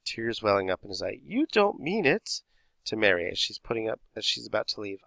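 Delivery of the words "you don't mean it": mock crying. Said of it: "Stan starts to cry".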